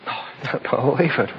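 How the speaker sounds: quiet disbelief. Real, not acted. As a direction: mutter it, half rueful. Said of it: "I suddenly realized".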